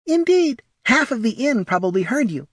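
tiny, very high kobold voice; high-pitched, disapproving small creature. Acted. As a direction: pipe up primly in a high little voice. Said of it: "said a small voice".

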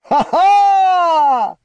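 huge high booming laugh; manic laugh. A narrator's reading. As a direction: laugh like a crazed hero. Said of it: "a supersonic laugh".

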